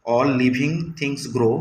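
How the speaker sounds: slow, deliberate sentence; slow reading aloud. Shown as a demonstration. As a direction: give each word its own beat. Teacher reading example. (ধীরে পড়া)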